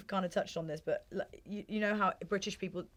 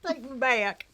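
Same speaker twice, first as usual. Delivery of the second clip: higher, louder put-on American country accent; imitated country accent; 5 semitones higher, 5 dB louder than usual. Host joins guest's farm joke, imitated country accent. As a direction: echo the guest's bumpkin accent. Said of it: "That accent".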